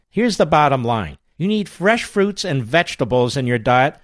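upbeat host-read ad. Acted. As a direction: sell it like a radio ad. Host reads sponsor ad.

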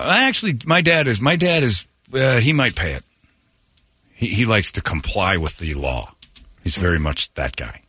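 ordinary talk, nothing marked

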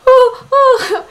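high, loud yelps of pain; pained cry. Retold or imitated. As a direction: cry out as the tray burns her arm. Arm burning on the tray.

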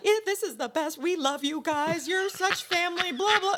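high, gushing, over-sweet voice; gushing mock praise. Mocking how they gushed over the waitress. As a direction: lay the affection on thick and high.

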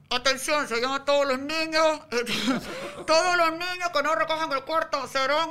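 anuncio por altavoz, algo agudo y con risas; announcer voice. Acted. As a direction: anunciar a todos los niños como por megáfono. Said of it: "tengo otro que es así". (voz de anuncio por altavoz)

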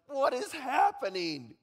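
high, loud, slow disbelief; mock bewilderment. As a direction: cry out in exaggerated confusion. Voicing confused crowd.

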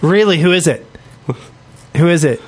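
ordinary talk

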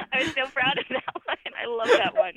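high, bright, loud gush of delight. Real, not acted. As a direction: squeal how much you love it. Gushing over own haiku.

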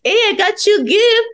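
high, sugary fake kindness; mock sweet. Teasing the relatives after hiding rats in purses, high mock-sweet voice. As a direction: offer it up high like a sham present. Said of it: "and I'll be like".

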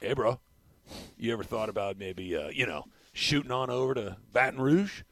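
lazy California surfer pitch; mock surfer dude. Coach recruiting a Mater Dei kid as a surfer dude. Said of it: "Mater Dei".

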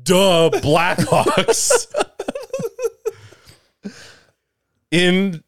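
reading through giggles; fighting back laughter; giggling. Real, not acted. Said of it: "Now I can't talk normal".

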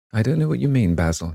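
exclaiming. A narrator's reading. Dorian turns round on Basil, exclaiming. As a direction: exclaim it in impatient bafflement. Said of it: "he exclaimed, turning round".

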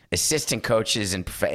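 ordinary delivery

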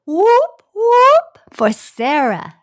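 high, loud, slow whoop whoop; loud cheer. Acted. Co-host cheering for Sarah on air, loud and high.

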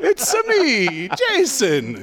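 high, cartoonish Italian accent like Mario. Cohost answering the Mario jab with a squeaky Italian accent. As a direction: chirp it out as a video-game Italian plumber. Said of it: "every time you do your Italian accent".

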